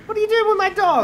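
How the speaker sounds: high, loud, fast yell; indignant high shout at a thief; outraged confrontation. Pretend. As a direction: demand it at speed, voice way up.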